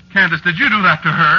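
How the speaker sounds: high, loud shocked demand; horrified outcry. Acted. Husband seeing the girl turned squirrel, horrified loud question.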